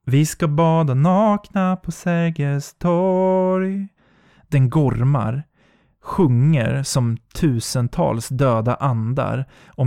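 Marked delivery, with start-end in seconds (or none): 0.0-3.9 slow, distant eerie lilt; high slow phantom voice; ghostly beckoning. Acted. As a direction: coax her toward the water.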